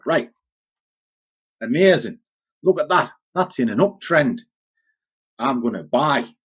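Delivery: lightly hammed-up eager novice trader; mock excitement.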